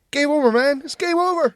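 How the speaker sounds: high, strained panic over the game being lost; panicked Hudson quote. Retold or imitated. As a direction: squeal it out like a marine losing it. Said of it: "two honourable mentions".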